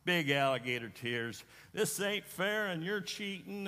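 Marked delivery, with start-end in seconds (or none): 1.7-3.7 put-on high, whiny child's wail, pitched way up; mock sob of a boy losing at cards; mock crying kid. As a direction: imitate a little boy crying that it's unfair. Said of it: "he started to cry".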